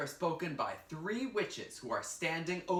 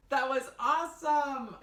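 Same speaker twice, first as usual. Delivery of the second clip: excited; 8 semitones higher, 6 dB louder than usual. Real, not acted. Teacher cheering after the play.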